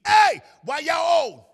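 put-on high, loud, slow kid's yell; yelled question. Boy yelling at parents from the back seat, loud and high. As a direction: shout it from the back seat, laughing. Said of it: "so I yelled to the front seat".

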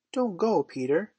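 high plea falling away on the name; entreating. A narrator's reading. Wendy begs Peter not to leave, entreating. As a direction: beg him to stay, pitched high. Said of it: "she entreated".

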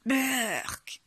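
high gasp, then a drawn-out yuck; grossed-out outcry; disgusted exclamation. A narrator's reading. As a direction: recoil in disgust.

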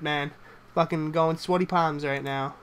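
ordinary talk

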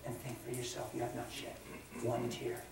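God quietly correcting the grieving man, soft; quiet rebuke. Retold or imitated. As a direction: rebuke him softly, almost hushed.

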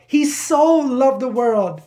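"so" held long and high; slow, passionate weight on "so"; stress on SO. Shown as a demonstration. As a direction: lean hard into the word "so". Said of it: "The emphasis on that word so".

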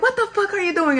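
high-pitched imitated scolding; angry yell. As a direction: bark it at the recruit. Chief yelling at recruit.

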